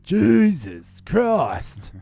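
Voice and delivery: drawn-out, high, loud Australian oath; Aussie exclamation. Acted. Joking that Jesus was an Aussie, long loud Australian exclamation. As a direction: stretch it out in a broad Aussie accent. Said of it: "what if Jesus was an Aussie".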